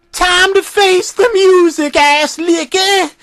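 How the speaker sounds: high, slow menacing howl; howled threat. Acted. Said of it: "Jack Skellington howled".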